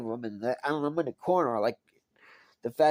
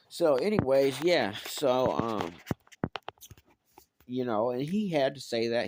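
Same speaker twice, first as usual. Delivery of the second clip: ordinary delivery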